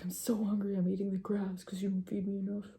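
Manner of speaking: soft, whispered complaint; dog's inner voice. Pretend. Dog complaining it is underfed, in a soft whispered voice. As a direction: grumble as the hungry dog, whispered. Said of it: "It's like".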